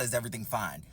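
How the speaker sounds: low, muted echo of the question; dropped-pitch, dismissive repeat; deadpan echo. Retold or imitated. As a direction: throw the question back, low and unimpressed. Throwing waitress's question back.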